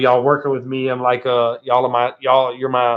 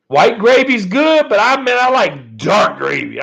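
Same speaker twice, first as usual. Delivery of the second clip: relishing; 6 semitones higher, 4 dB louder than usual. Real, not acted. Savoring talk of gravy.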